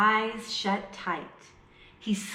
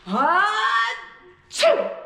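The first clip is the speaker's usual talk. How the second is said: big booming high sneeze; loud sneeze; 12 semitones higher, 7 dB louder than usual. Acted. Bear wakes with a huge, loud sneeze. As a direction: let the whole sneeze explode.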